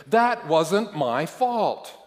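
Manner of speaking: high, loud, slow protest; desperate objection. A narrator's reading. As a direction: plead innocence loudly, stretching every word. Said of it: "starting to feel desperate".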